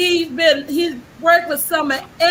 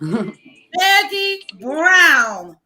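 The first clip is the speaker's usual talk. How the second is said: very slow, drawn-out name reveal; announcer's introduction; much slower than usual. Real, not acted. Host introduces next guest to the audience, announcer's introduction. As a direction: stretch the guest's name out slowly.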